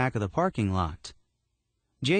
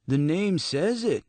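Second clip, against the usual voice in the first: high, lightly voiced tough guy; a hint of a cocky street kid; tough teen voice; 8 semitones higher than usual. A narrator's reading. Eduardo voiced.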